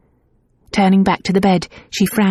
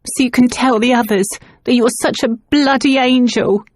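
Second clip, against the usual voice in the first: higher, bitter accusing tone; bitter accusation; 6 semitones higher than usual. A narrator's reading. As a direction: throw it at her, resentful. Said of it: "Nan accused".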